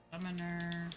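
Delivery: ordinary talk, nothing marked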